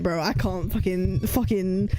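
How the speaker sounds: normal talking voice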